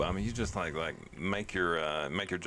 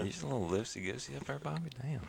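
teasing; 7 semitones higher, 5 dB quieter than usual. Real, not acted. Teasing friend about drinking.

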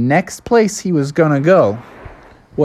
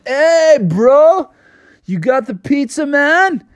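laid-back surfer dudes; 11 semitones higher than usual. Acted. Laid-back islanders greet him.

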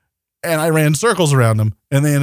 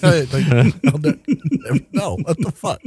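laughing. Real, not acted. Laughing while repeating.

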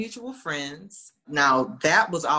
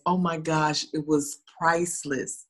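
usual speaking voice

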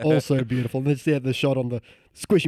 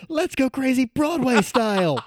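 yelled line; 12 semitones higher, slower than usual. Retold or imitated. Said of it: "do we even yell out".

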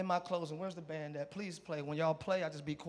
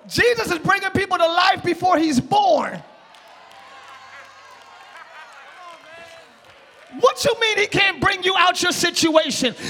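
loud, higher shouted preaching; preaching at full force; 5 semitones higher, 8 dB louder than usual. Acted. Pastor stirring the congregation, shouting excitedly. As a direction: whoop it up for the church.

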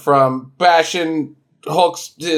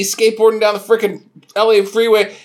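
ordinary delivery

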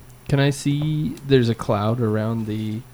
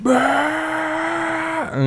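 excited; 13 semitones higher than usual. Acted. Raging barbarian charge.

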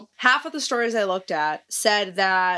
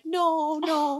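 high-pitched, mock-distressed refusal; retold dismay; 9 semitones higher than usual. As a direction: plead against bad news, voice lifted high. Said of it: "I was real sad about it".

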